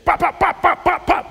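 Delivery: high, loud popping sounds; mock rapid-fire pops. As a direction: pop them out like quick hits. Mimicking the hits on the field.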